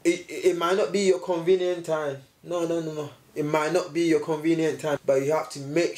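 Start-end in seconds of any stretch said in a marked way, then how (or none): none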